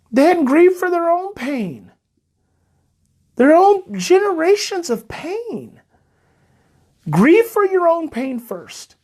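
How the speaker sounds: fighting back tears as he speaks; getting emotional. Real, not acted. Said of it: "I start getting emotional".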